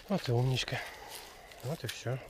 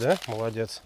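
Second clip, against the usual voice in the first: ordinary talk, nothing marked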